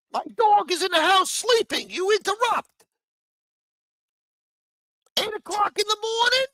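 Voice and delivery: high shouted put-on complaint; mock angry old man. Mocking the mugshot man yelling at his gardener, high and loud. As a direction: scold like a cranky neighbor. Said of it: "this is how he was talking to the gardener".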